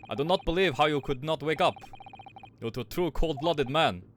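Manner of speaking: hammed-up Russian accent; terrible Russian accent. Sailor accusing a waking prisoner, terrible Russian accent. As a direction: put on a stern, bad Russian accent. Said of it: "they're all getting this fucking terrible Russian accent".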